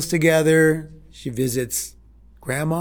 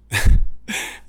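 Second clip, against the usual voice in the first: laugh; 7 dB louder than usual. Real, not acted. Laughing at the guard's surprise.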